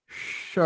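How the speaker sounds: "श" as sh, tongue drawn back; isolated sh in "श", held out; SH sound demo. Shown as a demonstration. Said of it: "और हम श बोल रहे हैं". ("श" जीभ पीछे करके)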